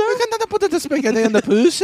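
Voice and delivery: high, loud mock Italian accent; hammed-up Italian accent. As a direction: parody the Italian pizza rant. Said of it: "Italian accent".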